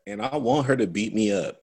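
ordinary talk, nothing marked

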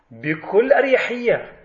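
normal talking voice

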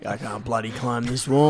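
put-on broad Australian accent. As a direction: ocker Australian voice. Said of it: "racist Australian accent".

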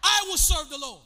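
loud, high rallying shout; preacher's shout. Shown as a demonstration. Preacher has the church say it with him, shouting. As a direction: shout it for a crowd to repeat. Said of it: "screaming in my ear".

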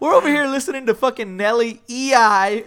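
high, loud, incredulous retold rant; mock disbelief. Joining friends mocking their music choice, hyped disbelief.

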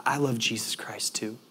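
hushed, choked-up whisper; tearful whisper. Retold or imitated. To the Spanish-speaking stranger on the plane, a tearful whisper. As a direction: breathe it out through tears. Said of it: "with tears welling up in my eyes".

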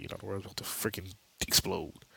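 hushed, breathy doom; whispered warning. Retold or imitated. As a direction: whisper it like a secret. Billy warning the world ends.

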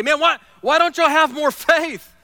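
browbeating. Pretend. Jesus scolding doubting disciples, mock browbeating. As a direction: lightly berate them for lacking faith. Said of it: "he doesn't browbeat them and say".

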